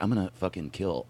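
low, quiet seething threat; seething under breath. Retold or imitated. Show runner silently watching someone move a chair, seething under breath.

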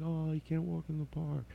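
soft, high sympathetic echo; pitying murmur. Retold or imitated. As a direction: coo it softly, like pitying him. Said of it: "I'm like".

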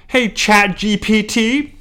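calling the assistant. Acted. Calls out to the AI assistant.

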